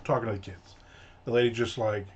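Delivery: ordinary talk, nothing marked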